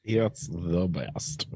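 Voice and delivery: slow, low and soft; low, soft, slow echo. Retold or imitated. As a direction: drawl it out quietly. Echoing the trailer line.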